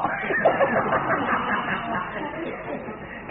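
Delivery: high chuckle; real laugh. Real, not acted. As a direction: laugh at your own joke. Laughs at cigarette joke. (tertawa kecil)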